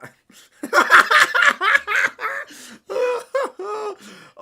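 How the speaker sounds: shrieking hysterical laughter; screaming laugh. Real, not acted. Streamer shrieking with laughter at the goal.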